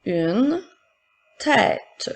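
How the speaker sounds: word by word with pauses; careful dictation for pupils to write. Shown as a demonstration. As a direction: dictate slowly, each word set apart. Teacher repeating dictated words.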